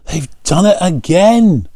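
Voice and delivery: high, loud amazed gasp; gasping amazement. A narrator's reading. A local fisherman gasps at the catch, high and loud. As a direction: gasp in disbelief at their luck. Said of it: "gasped a local fisherman".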